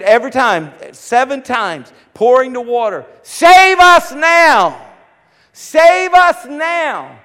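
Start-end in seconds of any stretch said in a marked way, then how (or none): 3.2-7.2 high, loud, slow chanted cry; shout in unison. Retold or imitated. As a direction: cry out like a choir of worshippers. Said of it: "shout it together in unison".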